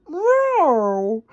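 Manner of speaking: high yowling cat meow; yelling meow. Acted. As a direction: yowl like a scared cat. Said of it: "yelled Pilchard".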